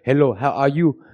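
lightly voiced African accent greeting; imitating African accent. Host explains the difference, lightly imitating an African accent. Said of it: "they all talk like this".

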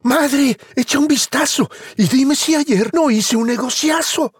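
put-on high, proud boy call. Juan calls his mother to see the beanstalk. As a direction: call out excitedly to mum. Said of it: "Juanito gritó".

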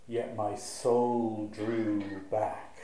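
very slow, weighed down; heavy and slow. Shown as a demonstration. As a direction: drag the line out as if the soul pulls back. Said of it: "You can feel the heaviness".

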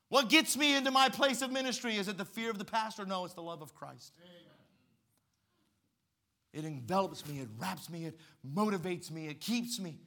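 loud fervent preaching; preaching at full force. Acted. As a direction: preach it out with fire.

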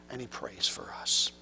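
whispered, low and soft; hushed aside. Real, not acted. Preacher whispering to the congregation, hushed and tender.

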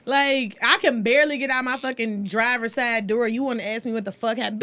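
ordinary talk

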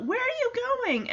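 put-on high-pitched child-like call. Boy calls after the departing animals, high questioning call. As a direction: lift the voice high, as if calling after someone. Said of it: "And Tucker calls up".

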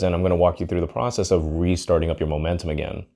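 ordinary talk, nothing marked

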